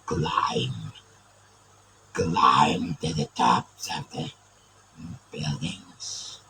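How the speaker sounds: deep, booming villain shouts; Galvatron voice. Pretend. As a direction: roar commands like a giant evil robot. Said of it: "a Galvatron, I guess".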